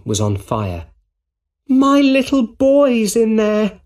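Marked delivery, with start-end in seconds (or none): 1.7-3.8 high, loud, drawn-out cry; screamed. A narrator's reading. Frantic mother at a burning building, screaming for her boy. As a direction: scream it as acted story dialogue. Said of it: "a woman screamed".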